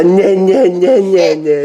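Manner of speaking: high, loud nasal-syllable chant; mock a fussy baby to get giggles; playful high "nah-nah" imitation; mimicking baby fussing. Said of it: "your brother and the nah, nah, nah".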